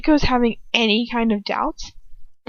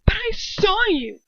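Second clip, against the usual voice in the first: slow, higher desperate insistence; insist, pleading; desperate protest; 10 semitones higher than usual. Acted. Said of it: "I say desperately".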